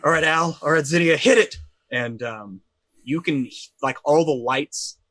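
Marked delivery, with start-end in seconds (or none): 0.0-1.6 loud, raised-pitch call to the crew; yelled order. Acted. Engineer yells to Zinnia to start the power, urgent. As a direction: yell over the intercom, peaking on the last words. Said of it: "as she yells over the thing".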